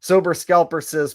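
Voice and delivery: ordinary delivery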